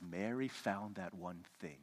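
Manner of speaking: Jesus softly praising Mary; gentle Jesus. Retold or imitated. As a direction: promise it quietly and kindly. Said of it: "And he says".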